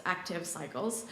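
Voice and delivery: ordinary talk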